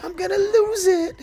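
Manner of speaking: high cracking wail of frustration; strained wail at the game; anguished wail. Real, not acted. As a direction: wail it like you're about to lose it.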